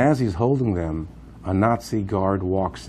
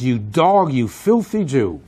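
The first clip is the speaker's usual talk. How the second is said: loud, higher imitation of an angry guard; 4 semitones higher, 5 dB louder, slower than usual. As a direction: hurl the insult with raised voice. Guard insults prisoner before hanging.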